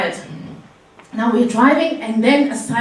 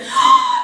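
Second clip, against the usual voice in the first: a very high sudden gasp; sharp shocked gasp. Retold or imitated. Cousin gasping as the baby comes out.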